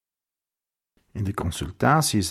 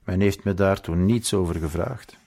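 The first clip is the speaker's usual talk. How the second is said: usual speaking voice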